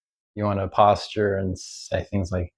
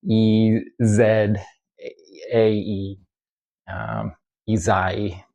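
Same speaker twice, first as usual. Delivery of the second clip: ordinary talk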